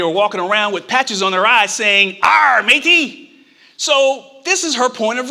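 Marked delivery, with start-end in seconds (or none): 2.2-3.2 slow, drawn-out pirate growl. Retold or imitated. Cartoon kids greet each other in a slow hammed-up pirate voice.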